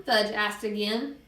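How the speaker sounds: usual speaking voice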